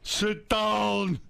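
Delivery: high, long-held shouted orders; yelling dad impression. Father ordering his child around, high furious shout. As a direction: holler it out as Dad.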